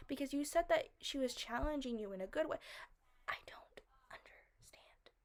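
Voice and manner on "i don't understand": slow, much softer whisper; a baffled whisper to herself. Real, not acted. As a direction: let it fall away into a whisper. Repeats it, drops to whisper.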